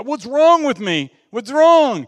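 acted-out bewildered alarm, voice pushed up high; distressed questioning. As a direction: play a mock-distraught complainer. Acts out distressed, convicted new believer.

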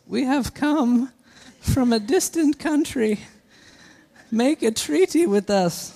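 different voice. Acted. Pastor voices the Gibeonites begging Joshua, high and parched. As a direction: rasp it out like a thirsty, weary traveller. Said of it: "I kinda wanna read that in a different voice".

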